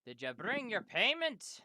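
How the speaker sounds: loud, high-swooping demand for payment; demanding ogre. Acted. Ogre at the longhouse demanding payment, loud demand. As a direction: bellow it as the ogre collecting what's owed. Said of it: "he's like".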